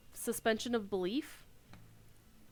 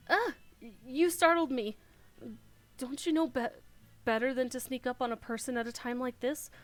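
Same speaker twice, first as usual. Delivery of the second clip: startled townsperson, raised and jumpy; a jumpy local caught off guard; startled NPC; 5 semitones higher, 4 dB louder than usual. Acted. Streamer voicing a startled quest NPC, raised and jumpy.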